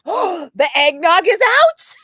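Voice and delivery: slow, high, loud squeal of delight; crazy-person excitement. Retold or imitated. Retelling her eggnog freakout to the rep, squealing. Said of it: "Like a crazy person".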